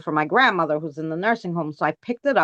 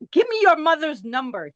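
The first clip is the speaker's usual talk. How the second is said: retold rant; 7 semitones higher than usual. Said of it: "I went off on this poor guy".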